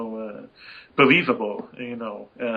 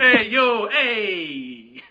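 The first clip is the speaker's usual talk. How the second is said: hammed-up, drawn-out American Italian hail; American Italian accent; 6 semitones higher, much slower than usual. Imperial hails the player in mock American Italian. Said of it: "sound like American Italians".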